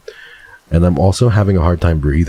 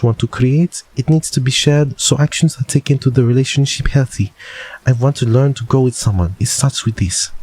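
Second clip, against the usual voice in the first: higher put-on French voice; pitched-up French-accented reading; 5 semitones higher than usual. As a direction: read it aloud in a mock French accent. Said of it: "you still have a French accent".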